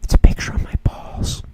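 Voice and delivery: breathy conspiratorial whisper; teasing a friend in a hushed whisper. Acted. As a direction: whisper it like a naughty secret. Whispered naughty joke.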